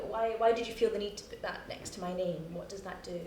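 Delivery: usual speaking voice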